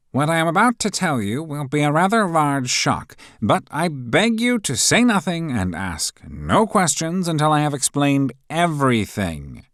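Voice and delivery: high, loud fussy professor voice; pompous professor taking charge; stern professor. A narrator's reading. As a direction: announce it like a pompous teacher.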